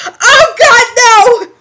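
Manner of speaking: high, wailing cry of dread; dramatic dismayed shriek; horrified. Real, not acted. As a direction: cry out in comic horror. Reader reacts to sinister turn.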